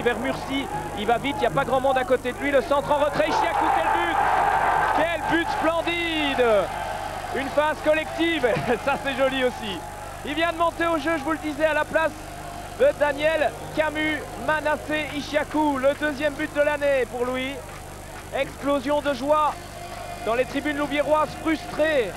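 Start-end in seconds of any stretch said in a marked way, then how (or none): 2.5-8.5 excited sports commentary. Real, not acted. Goal scored, live call. 10.2-22.1 high, elated commentary; excited sports commentary. Real, not acted. After goal, still elated.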